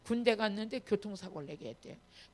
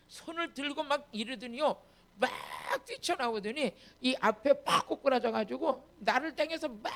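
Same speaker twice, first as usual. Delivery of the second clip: ordinary delivery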